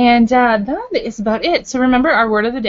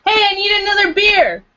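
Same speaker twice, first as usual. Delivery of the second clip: shouted call for beer, much higher and louder; yell; 12 semitones higher, 4 dB louder than usual. Real, not acted. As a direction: call out across the room for another beer. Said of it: "sorry, didn't mean to yell at you guys".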